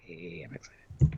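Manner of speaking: soft, slow, voiceless mock cheer; half-hearted cheer. Host asking co-host if he is excited, half-hearted mock cheer. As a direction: feign excitement in a hushed, drawn-out yay.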